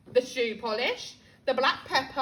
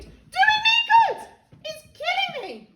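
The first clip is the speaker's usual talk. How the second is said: screaming; 9 dB louder than usual. Acted. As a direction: shriek in outrage. Grandma screaming on.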